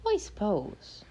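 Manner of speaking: high little leprechaun voice. Acted. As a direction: pitch it up like the leprechaun. Leprechaun's voice, pitched up.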